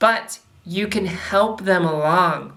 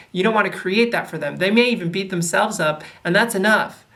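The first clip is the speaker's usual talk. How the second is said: normal talking voice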